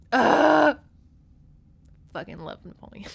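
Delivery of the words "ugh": loud high groan of delight; loud ugh. Real, not acted. Vlogger groaning loudly at the cute moment. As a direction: groan at the cuteness. Overwhelmed by cuteness.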